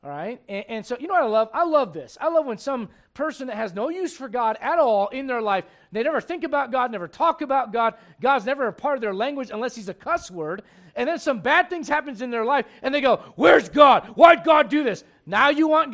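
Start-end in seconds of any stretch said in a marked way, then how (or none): 0.5-13.2 loud, high, driving preacher voice; fiery preaching. Acted. Preaching fired up at the unbeliever. 13.4-15.0 loud, high mock shout; mock outcry. Mocking the unbeliever's cry.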